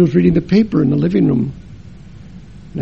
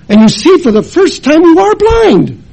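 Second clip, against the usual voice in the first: preaching climax; 11 semitones higher, 7 dB louder than usual. Acted.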